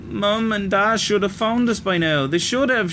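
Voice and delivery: high, tearful, stubborn plea; insisting through sobs. A narrator's reading. Little girl sobbing that their parents should have come. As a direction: insist while crying. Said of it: "she insisted through her sobs".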